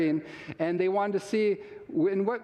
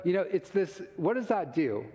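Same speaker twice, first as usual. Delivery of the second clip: usual speaking voice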